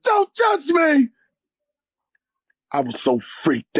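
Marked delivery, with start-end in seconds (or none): 0.0-1.1 high frightened yell, second slower; terrified shout. Retold or imitated. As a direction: push him off in panic. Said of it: "I said".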